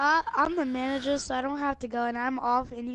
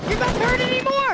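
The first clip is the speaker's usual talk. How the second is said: loud high shout; excited shout; 11 semitones higher, 8 dB louder than usual. Acted. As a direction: yell it out triumphantly. Kid heals daddy loudly.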